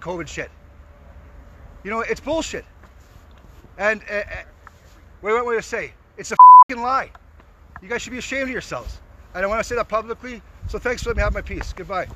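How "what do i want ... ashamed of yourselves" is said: loud furious outburst; real burst of anger; angry shout. Real, not acted. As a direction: spit the accusation out. Angry protester confronting filmmakers.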